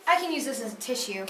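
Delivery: ordinary talk, nothing marked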